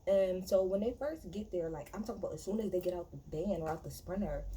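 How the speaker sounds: normal talking voice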